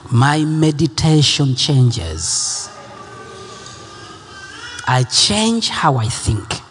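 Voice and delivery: low, slow, prayerful repetition; hushed worship leader, unhurried. Acted.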